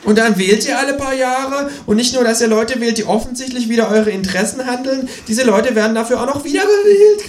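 high-pitched cartoon kangaroo rant; squawking indignant kangaroo voice; croaking kangaroo. A narrator's reading. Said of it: "krächzt das Känguru".

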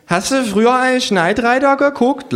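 hohe Figurenstimme, angetrunken; hoch und lallend fragen; lallendes Känguru. Acted. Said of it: "lallt das Känguru". (hohe, beschwipste Känguru-Stimme)

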